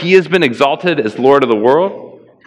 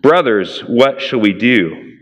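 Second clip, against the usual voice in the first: measured Bible reading, mostly a bit lower; scripture reading; 5 semitones lower than usual. Acted. Pastor reading Acts aloud to the congregation, measured and solemn.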